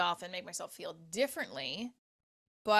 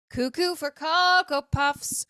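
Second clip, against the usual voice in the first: ad jingle voice; 7 semitones higher, 6 dB louder, slower than usual. Pretend. Cereal slogan joke.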